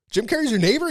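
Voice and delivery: high, loud amazed question; amazed disbelief. Retold or imitated. Retelling his shock to the neighbor, high amazed disbelief. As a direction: ask it like you can't believe it.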